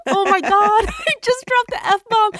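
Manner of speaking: high, laughing shock; laughing in disbelief at herself. Retold or imitated. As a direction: laugh at your own nerve. Shocked at own swearing.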